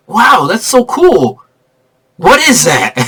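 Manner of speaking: clueless awe. Retold or imitated. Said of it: "Tobey Maguire is like".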